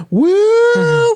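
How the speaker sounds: high, sustained mock siren wail; siren imitation. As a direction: wail like an air raid siren. Imitating the air raid siren.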